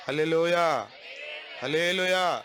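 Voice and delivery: loud preacher's hallelujah call; ringing shout of praise. Real, not acted.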